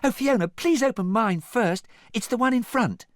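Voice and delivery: high, loud, eager pleading; squealing princess excitement; excited. A narrator's reading. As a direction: beg excitedly to go first. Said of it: "Cinderella pointed excitedly".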